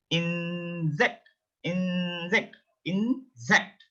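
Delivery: "Insect" as in-ZEKT, n held long; German pronunciation. Shown as a demonstration. Teacher drills the German I word, German pronunciation. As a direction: split "Insect" into two drawn-out parts. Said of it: "it is E".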